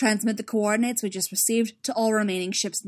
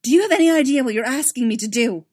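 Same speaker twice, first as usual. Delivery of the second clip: loud, higher, furious demand; 5 semitones higher, 11 dB louder, faster than usual. Acted. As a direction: hurl the question back in disbelief. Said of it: "Emil demanded".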